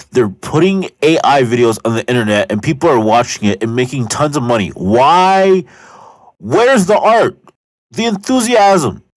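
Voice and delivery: loud, high, building outrage; exasperated rant. Real, not acted. Ranting about AI money videos.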